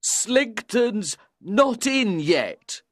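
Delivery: put-on high, slow, drunken holler; loud drunk man. A narrator's reading. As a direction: call it out like a loud drunk, drawn out. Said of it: "he said loudly".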